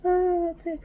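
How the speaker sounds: normal talking voice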